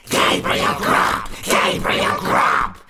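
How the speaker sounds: slow, screeching chant of the name; wild scream. A narrator's reading. Invisible voices fill the churchyard, screaming wildly. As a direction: scream it like a wild ghostly chorus. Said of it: "screamed a wild chorus of voices".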